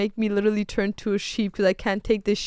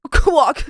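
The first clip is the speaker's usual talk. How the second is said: duck quack; 3 semitones higher, 8 dB louder than usual. Acted. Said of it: "like, a duck".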